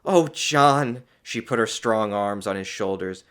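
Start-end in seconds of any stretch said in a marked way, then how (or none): 0.0-1.0 elated. A narrator's reading. Rejuvenated woman rejoicing to doctor.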